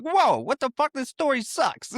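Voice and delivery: high-pitched protest, imitating angry listeners; mock outrage. Podcaster acting out listeners rejecting the story, mock outrage. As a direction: cry out in hammed-up disbelief.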